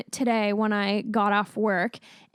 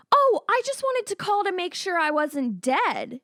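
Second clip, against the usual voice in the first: high, slow, sweetly sarcastic; mock innocent; 7 semitones higher, slower than usual. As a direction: play dumb, sing-song and slow. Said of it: "And I was like".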